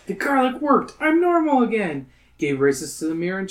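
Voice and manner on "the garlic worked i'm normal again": higher, bright celebration; joyful announcement. Acted. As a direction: exclaim the good news. Cured kid celebrating.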